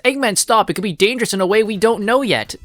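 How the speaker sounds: mock-urgent cartoon warning, quickly. Acts out Tails warning Eggman.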